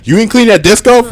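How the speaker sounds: high, loud demanding question; mock aggressive nagging. Imitating partner catching him off guard, high aggressive nagging. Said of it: "Why are you being so aggressive?".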